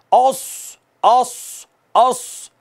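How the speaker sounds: औस with a sharp, hissing स; whistled s. Shown as a demonstration. Teacher shows the whistling sad sound. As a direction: hiss the स in औस clearly. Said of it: "सीटी है".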